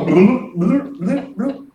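comic underwater bubble noises, dying away; imitated bubbling. Papa describing sinking in the river, imitated bubbling. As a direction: mimic bubbles as someone sinks.

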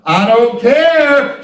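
I don't care stretched long and slow; slow, defiant I don't care; slow drawn-out declaration. Shown as a demonstration. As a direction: let every word of I don't care hang. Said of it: "Some of y'all need to say".

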